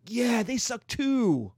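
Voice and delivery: high, drawn-out cheer; mock eager agreement. Russians bonding with French over shared hatred, mock eager cheer. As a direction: chime in high, stretching the words.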